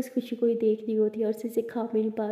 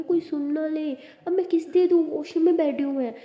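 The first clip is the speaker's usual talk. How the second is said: higher, louder, mildly exasperated; flustered protest; 5 semitones higher, 4 dB louder than usual. A narrator's reading. Abira refuses a kiss from the washroom, mildly flustered. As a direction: protest in lightly acted alarm.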